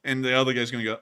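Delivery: ordinary talk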